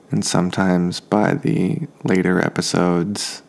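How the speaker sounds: normal talking voice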